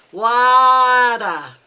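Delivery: loud, drawn-out cry for water; mock toddler hollering from the playpen; child's demanding call. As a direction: holler it like a thirsty kid who won't be ignored.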